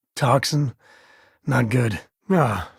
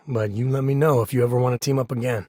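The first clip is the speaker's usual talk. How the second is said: usual speaking voice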